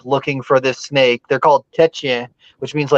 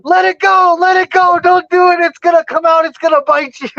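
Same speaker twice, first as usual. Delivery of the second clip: much higher, louder frantic pleading; panicked dad; 13 semitones higher, 3 dB louder than usual. Retold or imitated. The snake-fearing dad begging his son to let go, mock panic, high and loud. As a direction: beg him to drop it, imitating a terrified father. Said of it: "My dad's terrified of snakes".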